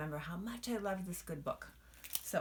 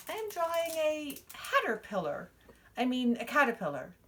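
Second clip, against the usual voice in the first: put-on girl's slip; 9 semitones higher, 6 dB louder than usual. Jenny's slip of tongue.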